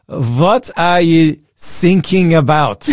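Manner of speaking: slow mock-German question; terrible German accent, drawn out; bad German accent. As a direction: do a hammy German coast guard. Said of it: "worst German accent on the planet".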